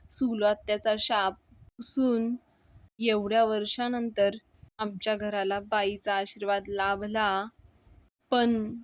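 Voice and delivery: usual speaking voice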